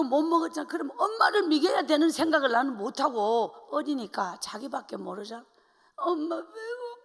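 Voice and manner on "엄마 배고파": put-on high, slow, tearful whine of a hungry child; crying child. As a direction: whimper like a starving little girl. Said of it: "하고 제가 우는 거예요". (우는 아이 목소리로)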